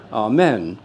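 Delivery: "Amen" as ah-MEN; short E in the last syllable of "Amen"; Latin-derived pronunciation. Shown as a demonstration. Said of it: "started pronouncing it".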